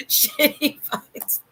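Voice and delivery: breathless laugh through "Shitty Pines"; laughing whisper. Real, not acted. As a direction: giggle it out slowly under your breath. Laughing repeat of the slip.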